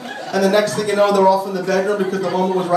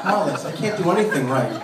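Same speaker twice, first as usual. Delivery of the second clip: normal talking voice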